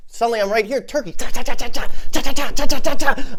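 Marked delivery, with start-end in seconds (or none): 1.1-3.3 loud rapid cha cha blows; fight noises. Acted. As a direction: beat out the punches with your mouth. Fight sound effects.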